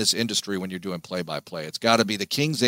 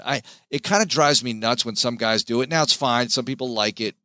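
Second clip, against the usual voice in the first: usual speaking voice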